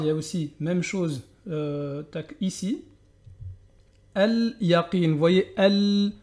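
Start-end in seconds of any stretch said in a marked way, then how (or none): 4.1-5.1 Al-Yaqin with a clear l; Al-Yaqin, l held; sounded lam. Shown as a demonstration. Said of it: "je suis obligé de le prononcer".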